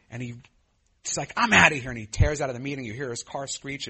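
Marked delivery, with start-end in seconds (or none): 1.3-1.9 snapped loud and high, storming off; angry exit. Retold or imitated. Angry man quitting the meeting, loud snap. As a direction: bark it as you storm out. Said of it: "he was so angry".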